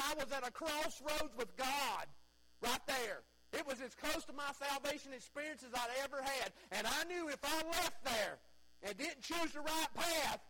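loud, high, driving preacher's cry; rising revival preacher shout; full-force preaching. Acted. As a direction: preach it out hard to the congregation.

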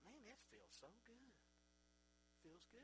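soft, sleepy sigh of relief; contented sigh. Retold or imitated. Worn out from cutting wood and lying down, soft contented murmur. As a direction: let it melt out as you sink into bed. Tired man lying down at night.

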